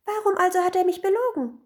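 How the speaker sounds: high, loud, fast accusation; high-pitched upset outcry; crying out. A narrator's reading. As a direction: burst out as a wronged wife. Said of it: "rief die Gattin".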